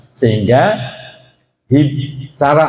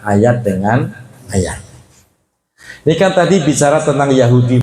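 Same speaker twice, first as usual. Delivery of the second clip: usual speaking voice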